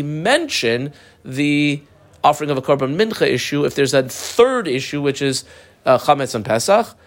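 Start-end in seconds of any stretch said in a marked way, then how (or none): none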